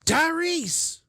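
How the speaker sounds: loud high shout of a name; alarmed call. Acted. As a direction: call out urgently across the yard. Calling out for Tyrese in alarm.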